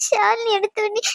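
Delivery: worried wife sobbing to a friend on the phone; tearful. Acted. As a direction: voice breaking with tears. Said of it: "அழுவாத".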